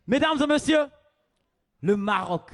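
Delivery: booming stage announcement; announcer voice. Acted. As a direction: call it out like a show host to the crowd. MC announcing to a crowd.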